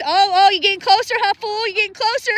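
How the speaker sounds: mock tough-guy challenge; playful trash talk at a goose; taunting the geese. Real, not acted. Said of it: "I'm yelling at the geese".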